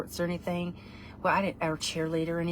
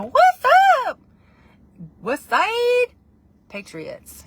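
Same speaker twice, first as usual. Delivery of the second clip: high, loud, stretched-out holler; hollered shout-out; 11 dB louder, much slower than usual. Pretend. Shouting out her school side, high whooping call.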